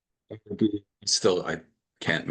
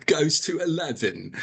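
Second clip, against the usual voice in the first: slow North London accent with a laugh, a bit higher; 7 semitones higher, slower than usual. Shown as a demonstration. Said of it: "I could do a beautiful North London accent".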